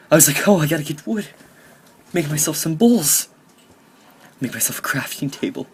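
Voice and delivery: hammed-up tearful retelling, pitched high; dramatic, emotional. Gamer recounts starving until chickens saved him, mock-tearful melodrama. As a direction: wail it in mock tragedy, high and loud. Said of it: "that was the most dramatic".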